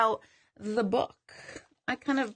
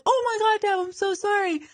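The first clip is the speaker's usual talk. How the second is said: much higher, startled apology; startled mom; 11 semitones higher than usual. Retold or imitated. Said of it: "She's like".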